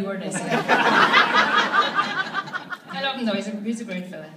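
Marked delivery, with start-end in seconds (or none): none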